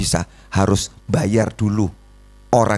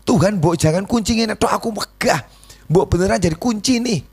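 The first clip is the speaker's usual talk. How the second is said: high, whining plea to God, half joking; whiny complaining prayer; 11 semitones higher than usual. Retold or imitated. Preacher mimics a believer whining to God. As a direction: pitch it up like a sulking believer begging.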